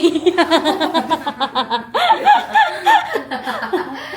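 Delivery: loud, long burst of laughter; big laugh. Real, not acted. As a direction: laugh helplessly and loudly. Laughing about being vlogged.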